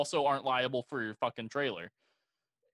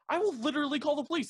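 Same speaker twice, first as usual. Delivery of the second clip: much higher and faster, "literally" driven hard; escalating retold threat; 12 semitones higher, faster than usual. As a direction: push the threat up to a near yell. Repeated police threat, escalating.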